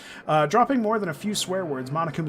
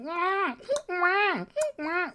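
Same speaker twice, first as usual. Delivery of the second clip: high wailing insect drone; imitated cicada wail; much slower than usual. Complaining about Japan's summer beetles, a high whiny wail. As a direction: mimic the tree beetles screaming like babies. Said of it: "Those stupid tree beetles that are just like".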